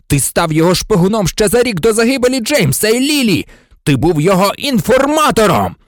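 high, loud, furious accusation; yelled accusation. A narrator's reading. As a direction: yell the charge at the traitor. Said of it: "заволав Блек". (гучне люте звинувачення)

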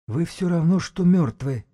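high, quavering wheeze; high-pitched hoarse threat; rattling, hoarse. A narrator's reading. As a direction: pronounce their doom in a high rattling rasp. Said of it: "голос его дребезжал и хрипел".

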